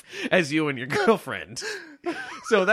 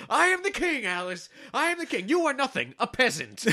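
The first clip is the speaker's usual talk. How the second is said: mock Ralph bluster; 10 semitones higher than usual. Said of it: "he goes, he's going".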